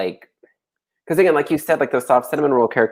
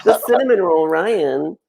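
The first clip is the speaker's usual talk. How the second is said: high slow cooing gush; cooing sweet voice; 6 semitones higher, slower than usual. Retold or imitated. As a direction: gush over him mockingly. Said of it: "Be like".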